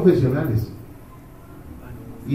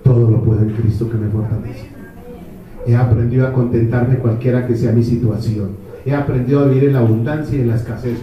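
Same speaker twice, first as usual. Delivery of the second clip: lower, steady voice of a faithful man; imitating a calm believer, lower; serene believer; 6 semitones lower than usual. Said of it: "Y dice".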